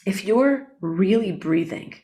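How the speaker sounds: ordinary talk, nothing marked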